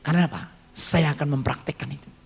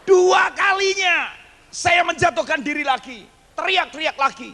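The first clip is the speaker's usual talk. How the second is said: high, slow, loud cry; preaching shout; 14 semitones higher, 4 dB louder than usual. Acted. Preacher retells his second fall, shouting. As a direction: belt it out to the congregation.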